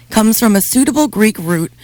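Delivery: ordinary delivery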